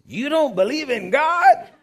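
high, slow, shocked roar; incredulous high shout. Retold or imitated. Big man roaring in disbelief at his own friend.

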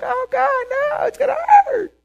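high, loud frightened whine; childish fearful wail. Retold or imitated. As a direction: whimper like a kid afraid of pain. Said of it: "We're like".

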